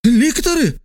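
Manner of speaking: very high sharp call; exclaiming. A narrator's reading. Trasul summons lictors simultaneously, high urgent cry. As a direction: cry out for the guards at the same moment. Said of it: "воскликнул одновременно с ним Трасул".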